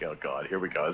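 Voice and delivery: a low, quiet, weary groan of dread; resigned mutter, bracing for trouble; exasperated. Retold or imitated. Tells his friends how he reacted to the creepy message, weary dread.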